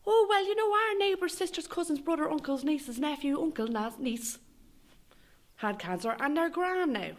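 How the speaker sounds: high, breathless gossipy mam; high fussy Irish mammy voice; mother's chatter. Retold or imitated. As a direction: rattle it off like a dismissive mother. Mother's voice in the story.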